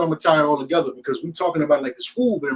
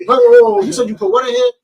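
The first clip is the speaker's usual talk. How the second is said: high, loud, disbelieving outburst; shocked disbelief; 6 dB louder than usual. Retold or imitated. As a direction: yelp it as if you just heard something gross. Prank victim reacting, retold.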